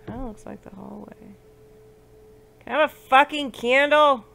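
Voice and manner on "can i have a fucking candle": annoyed demand. Real, not acted.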